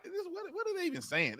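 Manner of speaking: high, baffled squeak of confusion; baffled mock quote. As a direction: squeak as if lost in a foreign tongue. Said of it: "I'm like".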